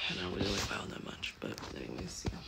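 whisper. Real, not acted. Private aside to camera.

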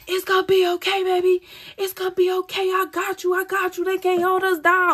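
high, airy ghost voice soothing her; ghostly comforting voice. Retold or imitated. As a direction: play a ghost whispering comfort from beyond. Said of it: "All I hear is ghosts like".

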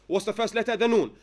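normal talking voice

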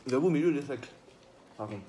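speaking between bites of burger; talking while chewing. Real, not acted. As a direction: mouth partly full of food. Seen 1: Bitten burger held at mouth; jaw working after bite, wiping mouth.

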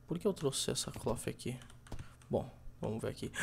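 ordinary talk

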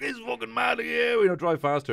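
high, loud shouting lads; rowdy lads egging him on. Retold or imitated. Lads on the bus cheering the drunk driver on, high and loud. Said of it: "Were like".

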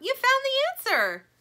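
high, loud delighted cry; joyful cry. A narrator's reading. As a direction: shout it with delight. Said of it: "cries Plastic, bouncing up".

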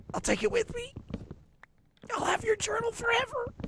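mourning a pet in a mock falsetto; squeaky falsetto vow. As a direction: squeak the promise up high like a cartoon mourner. Melodramatic farewell to Gavin.

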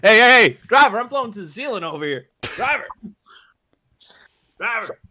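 high, loud panicked calls; calling out in alarm. Acted. Floating passenger calling for the driver in alarm. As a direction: yell for the driver while drifting up.

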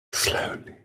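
breathy whispered warning. Acted. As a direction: whisper it so nobody hears. Cautioning quietly.

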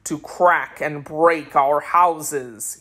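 low, slow, accusing sorcerer voice; deep sorcerer voice, slowly charging them with wickedness. Acted. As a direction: drag it out deep and cold, laying blame. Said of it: "he continued".

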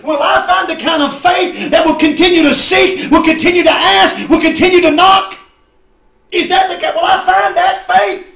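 high, loud, driving sermon shout; full-force preaching. Acted. Preacher presses church on persistent faith, shouting. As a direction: drive each phrase home at a shout.

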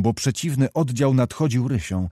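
usual speaking voice

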